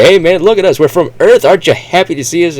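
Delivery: high, loud excited voice; hammed-up proud greeting; eager Earthmen. Earthmen greeting Martians, high excited voice. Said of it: "try to find as many Martians".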